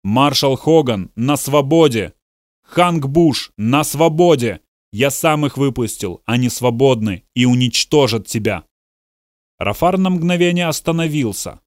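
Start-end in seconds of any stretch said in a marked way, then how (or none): none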